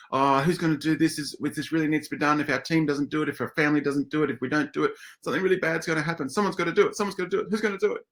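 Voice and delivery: hammed-up worried colleague; mock fretful voice. Presenter acts out a colleague fretting over undone work. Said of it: "actually what happens is".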